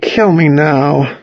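exaggeratedly pained, slow groaning delivery; moaning. A narrator's reading. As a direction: moan it with long, drawn-out words. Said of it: "moaned Toby".

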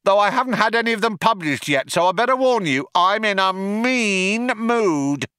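high, put-on cop voice calling out; second cop chiming in. A narrator's reading. Other cop chimes in to boast, calling out high. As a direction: pipe up proudly at high pitch. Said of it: "chimed in the other cop".

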